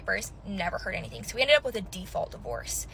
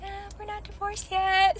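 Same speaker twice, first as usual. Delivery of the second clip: high, slow mock reply; 11 semitones higher than usual. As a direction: answer in a silly high voice. Her retold answer.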